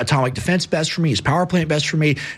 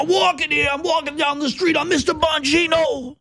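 mock New York accent; 12 semitones higher than usual. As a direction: belt it out like a cartoon New York street guy. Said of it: "people with those accents".

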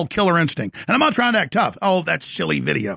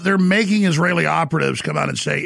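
ordinary talk, nothing marked

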